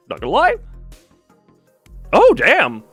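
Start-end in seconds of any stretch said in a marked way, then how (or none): none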